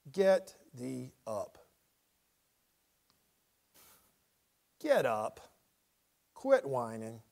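low, slow no-nonsense order; stern command with inflection. Acted. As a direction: drop low like scolding a whining child. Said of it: "read verse ten with a little voice inflection".

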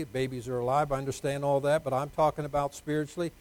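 ordinary delivery

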